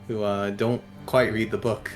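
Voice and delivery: ordinary talk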